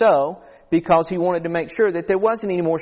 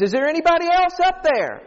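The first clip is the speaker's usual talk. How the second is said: much higher, comic call upward; mock calling out; 12 semitones higher than usual. Cliff man seeks someone other than God, mock high call. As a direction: play a doubtful man calling for another rescuer.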